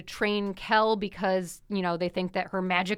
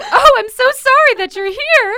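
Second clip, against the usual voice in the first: loud, high mock-flustered apology; Jonathan apologizing; 15 dB louder than usual. Friend acts out Jonathan walking in, mock flustered. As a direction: overdo the polite surprise. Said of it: "Jonathan comes in and is just like".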